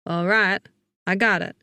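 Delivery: gruff southern twang; Mason. A narrator's reading. Man hearing of rabies, gruff southern twang. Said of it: "with a bit of a southern twang".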